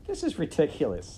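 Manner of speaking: high, loud indignation; indignant princess. Acted. Princess answering the rat's threat, indignant.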